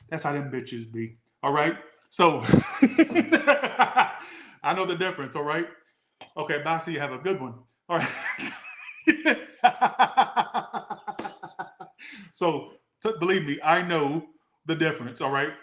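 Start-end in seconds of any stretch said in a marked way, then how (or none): 2.5-4.2 high burst of laughter. Real, not acted. Laughing at her church impressions, a high burst. 8.1-11.8 long high giggling laugh. Real, not acted. Still laughing at her church bit, a long high laugh.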